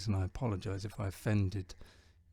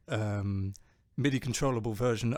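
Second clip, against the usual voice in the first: usual speaking voice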